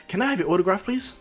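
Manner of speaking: excited, lifting high at the end; eager fan request. Acted. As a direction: ask like a starstruck kid. Fan begs for autograph.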